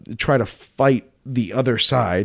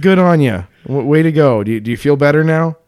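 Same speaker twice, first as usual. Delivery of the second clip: louder, higher mock congratulations; sarcastic praise; 4 semitones higher, 6 dB louder than usual. Mocking someone who spoiled a puppet show, sarcastic praise. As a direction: pile on the mock praise, punched up.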